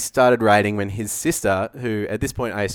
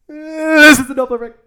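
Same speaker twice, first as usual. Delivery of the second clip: high voice moving past the mic; Doppler effect demo; 8 dB louder than usual. Shown as a demonstration. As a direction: swing past the microphone again. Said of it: "Do you want me to demonstrate it for you?".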